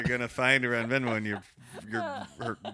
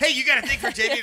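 loud, high, fast taunt; teasing buddy; 14 semitones higher, 9 dB louder, faster than usual. Retold or imitated. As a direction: needle a friend loudly. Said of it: "and you'd be like".

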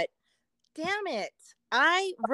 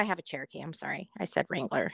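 sheepish whisper; 7 semitones lower, 9 dB quieter, faster than usual. Real, not acted. Correcting herself, whispered aside.

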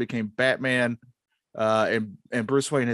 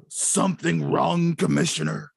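a hint of a brooding Batman; 3 semitones higher than usual. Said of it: "the part of Batman be played by Jason Batman".

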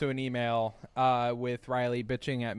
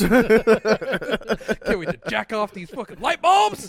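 slow, loud excited blurt; eager exclaim; 8 semitones higher, 11 dB louder, much slower than usual. Retold or imitated. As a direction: blurt it out, thrilled and drawn out. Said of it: "and I was like".

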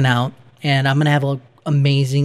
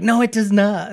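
higher-pitched mock objection; retold contradiction; 7 semitones higher than usual. Imitating co-host's Twitter rebuttal, higher mock objection. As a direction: mimic an indignant naysayer, pitched up. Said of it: "you're like".

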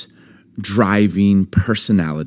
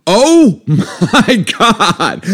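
high, drawn-out laughing exasperation; exasperated oh my God; 9 semitones higher, 3 dB louder, much slower than usual. Retold or imitated. Telling his wife how he reacted to the stalled driver, high and drawn out. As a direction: stretch it out, fed up. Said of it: "And I literally go".

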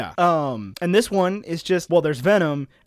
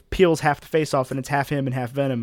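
ordinary delivery

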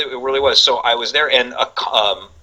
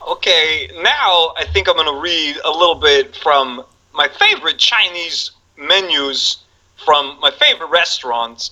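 higher, slow Walken drawl; Walken impression; 5 semitones higher than usual. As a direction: announce the menu reading like Walken. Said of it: "he's like".

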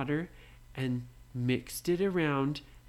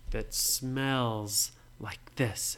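usual speaking voice

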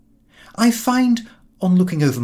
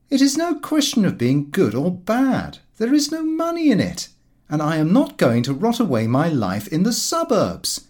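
Lupin's retort; 7 semitones higher than usual. Acted. Lupin voiced, dismissive reply.

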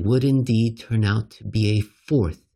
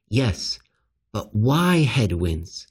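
ordinary delivery

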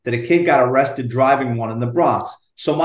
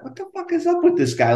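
high-pitched retold bafflement; mock disbelief, pitched way up; baffled. Retells his baffled inner reaction.

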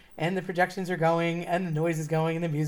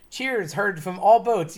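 ordinary talk, nothing marked

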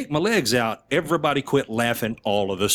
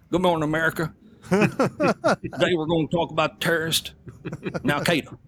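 impersonating the president; 5 semitones higher than usual. Retold or imitated. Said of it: "doing an impersonation of the president".

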